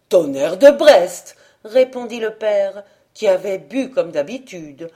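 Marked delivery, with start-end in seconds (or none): 0.1-1.3 louder, gruff curse; drunken oath. Acted. Drunk husband swearing at his wife's request, louder. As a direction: bark the oath out.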